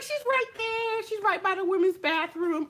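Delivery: high, helpful tattletale voice; hammed-up schoolgirl voice; eager gossip. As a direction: point her out eagerly. Informant pointing out rival.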